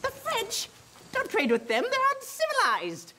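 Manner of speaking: high frantic pleading; panicked posh hatter. Acted. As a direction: beg shrilly for them to come back.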